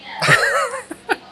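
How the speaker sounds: high excited mock gasp; mock gasp of kids. Imitating kids at ringside gasping in awe. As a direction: gasp like an awestruck kid. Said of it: "kids right here that are like".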